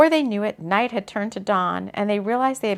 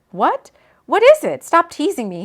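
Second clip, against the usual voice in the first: puzzled; 7 semitones higher, 8 dB louder than usual. Acted. Confused man asks what.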